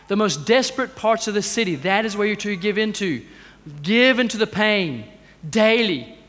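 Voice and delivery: usual speaking voice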